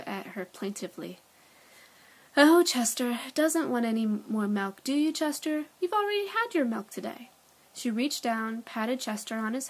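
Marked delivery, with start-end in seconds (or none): 2.4-7.3 high, sing-song pet talk; cooing to a pet. A narrator's reading. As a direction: coo at the cat, pitched up. Owner cooing to the cat.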